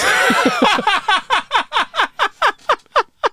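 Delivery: high burst of laughter at the punchline; big laugh. Real, not acted. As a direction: crack up at your own joke.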